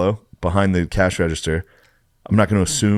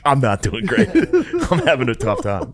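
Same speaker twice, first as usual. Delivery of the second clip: laughing admission; 4 semitones higher than usual. Retold or imitated. Retold to girlfriend.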